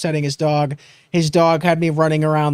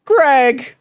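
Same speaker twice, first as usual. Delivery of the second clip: calling out; 9 semitones higher than usual. Retold or imitated. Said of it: "He goes".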